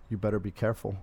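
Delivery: low, hushed ominous warning. Retold or imitated. A clerk warning a rafter in a low ominous voice. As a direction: warn her darkly, like a cashier with a secret. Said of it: "such an ominous voice".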